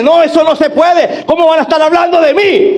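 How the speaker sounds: mock the listener who is outraged at gossip; mock indignation. Mocking listener's indignant reply.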